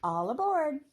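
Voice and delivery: high, loud call of All aboard; cheerful call. A narrator's reading. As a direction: call them aboard brightly. Blackbird calls out.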